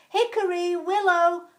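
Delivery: high, loud calling out of names; loud command. Acted. Head fox calling the two foxes, loud and commanding. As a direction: summon them sharply. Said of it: "commanded a loud voice".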